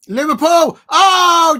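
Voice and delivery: high, loud yell of hope at the shot; excited shout. Real, not acted. Fan at a watch-along yelling at a near miss, loud and high. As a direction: shout it as the ball flies past the post.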